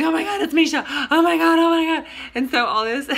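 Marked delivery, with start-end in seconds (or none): 0.0-2.1 high, loud breathless fangirl squeal; hyperventilating squeal. Retold or imitated. Squealing at first sight of Misha in line. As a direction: squeal like you just spotted your idol. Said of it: "we started, like, hyperventilating".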